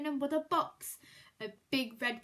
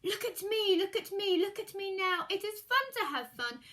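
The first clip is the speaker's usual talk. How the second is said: high, louder sing-song crowing; boastful cat; 7 semitones higher, 5 dB louder than usual. Acted. Cat showing off tricks to the children, excited crowing. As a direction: shout it out proudly while balancing.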